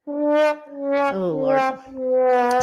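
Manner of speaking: normal talking voice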